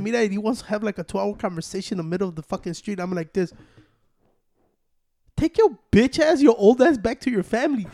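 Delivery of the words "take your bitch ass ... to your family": scornful telling-off. Retold or imitated. Retelling sending the old guy back to his family, scornful telling-off. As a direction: send him packing, drawing the words out.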